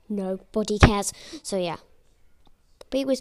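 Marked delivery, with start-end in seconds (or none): 0.1-1.1 slow, louder, fed-up put-down; weary dismissal. Retold or imitated. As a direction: sigh then drop it flatly on him. Sighing retort at the teacher.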